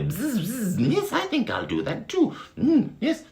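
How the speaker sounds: high, bright bee chirping; sing-song like a tiny bee; very high buzzing bee. Acted. Bee agreeing with its friend, very high voice. Bees chatting merrily.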